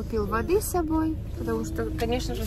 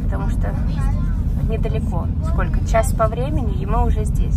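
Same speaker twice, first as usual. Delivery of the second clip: loud, bright to camera; vlog address; 8 dB louder than usual. Real, not acted. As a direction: tell the viewers where we are. Vlogger addresses viewers.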